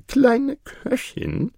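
high, slow drawn-out address; steward's address. Acted. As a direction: call her slowly, high and fussy.

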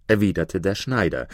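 plain reading voice

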